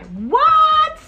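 very high, loud disbelief; a loud, high squeal of shock; shocked what. Real, not acted. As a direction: shriek the question in outrage. Reacts to wrong answer.

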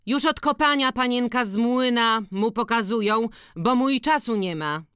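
high-pitched village woman; chatty peasant sister. A narrator's reading. Siostra opowiada o synu. (wysoki głos wiejskiej kobiety)

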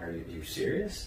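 soft, incredulous aside; inner disbelief. Retold or imitated. Team leader in his head at the accusation, muted disbelief. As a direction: think it aloud, low in volume. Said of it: "I was like, in my head".

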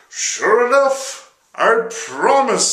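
high, loud, slow devil promise; drawn-out reluctant devil. Acted. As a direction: grudgingly agree as the devil. Devil voiced in story.

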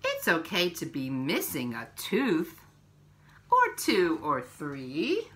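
slow, gentle picture-book reading; reading to children. Acted. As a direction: read each page to little ones, unhurried.

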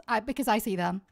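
ordinary talk

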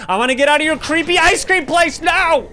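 high, loud desperate cry; frustrated yell. Real, not acted. Player yelling at the killer, high and loud. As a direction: yell to get away. Escaping Jerry.

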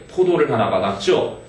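ordinary delivery

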